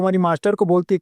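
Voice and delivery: ordinary delivery, nothing marked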